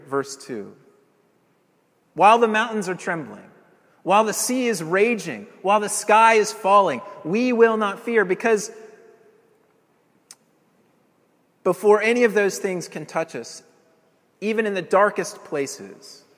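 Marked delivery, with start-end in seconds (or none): 2.1-7.0 rising, louder sermon climax; swelling, defiant proclamation; preaching crescendo. Acted. Builds to a climax. 7.2-8.2 defiant declaration. Shown as a demonstration. Defiant climax of the sermon. 8.2-16.2 rising, louder sermon climax; swelling, defiant proclamation; preaching crescendo. Acted. Builds to a climax.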